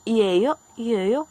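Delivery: "y ello" said clearly as a model; pronunciation demo. Shown as a demonstration. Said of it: "cambiaría la pronunciación".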